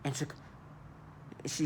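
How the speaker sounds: normal talking voice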